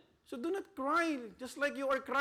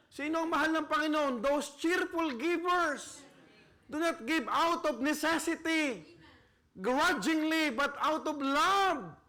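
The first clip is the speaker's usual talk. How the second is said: loud, high preaching climax; full-force preaching; 6 semitones higher, 6 dB louder than usual. Acted. Preacher urging church to give out of love, loud. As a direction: preach it out with fire.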